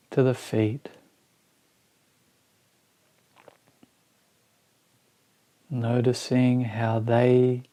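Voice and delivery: slow, spacious meditation guidance with long pauses; guided meditation. Acted. Teacher guiding listeners through a body scan, slow meditation voice. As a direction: ease each phrase out slowly, letting it settle.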